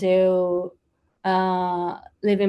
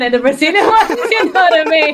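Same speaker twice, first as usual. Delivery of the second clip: higher, louder, laughing through the words; 8 semitones higher, 10 dB louder than usual. Real, not acted. Singer joking about her three accents, laughing.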